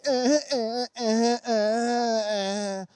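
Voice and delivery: reluctant hesitation. Retold or imitated. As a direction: hem and haw reluctantly. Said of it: "You're not like".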